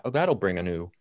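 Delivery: ordinary talk, nothing marked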